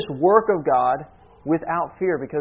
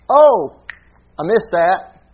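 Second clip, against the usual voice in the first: mock surprise; 3 semitones higher, 6 dB louder, slower than usual. Preacher mimicking an oversight God never makes, mock surprise. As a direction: act out catching a slip too late.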